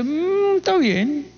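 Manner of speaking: high, sniffy "está bien"; proud dismissive. Retold or imitated. Imitating a proud man dismissing a better performer, high sniffy tone. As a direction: brush it off like a proud know-it-all.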